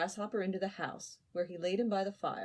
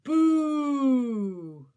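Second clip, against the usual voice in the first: loud, long rising boo; crowd booing; 6 semitones higher, 11 dB louder than usual. Acted. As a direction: jeer from the stands. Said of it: "roared the crowd".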